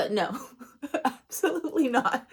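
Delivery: giggling through a denial; laughing. Real, not acted. Laughing at her own impression.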